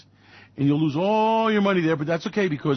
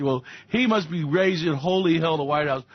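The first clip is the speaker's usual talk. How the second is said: usual speaking voice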